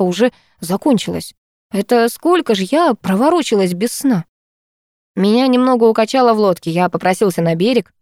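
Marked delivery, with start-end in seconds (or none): none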